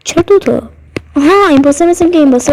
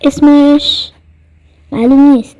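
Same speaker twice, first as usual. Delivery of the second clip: usual speaking voice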